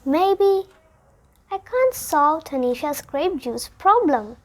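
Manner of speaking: ordinary delivery, nothing marked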